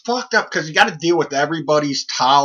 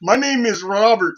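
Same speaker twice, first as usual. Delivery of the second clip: a hint of an Indian accent, names stretched; lightly mock a foreign caller saying "Robert"; call-center caller imitation; 8 semitones higher than usual. Prank-story caller introducing himself, lightly accented mock. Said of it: "Fucking Habib".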